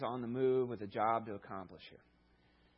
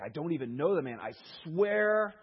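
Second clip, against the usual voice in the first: loud protest rising to a higher yell; mock desperate yelling denial; swearing an oath; 4 semitones higher, 7 dB louder than usual. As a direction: swear it, building to a shout. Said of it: "Peter denied it this time with an oath".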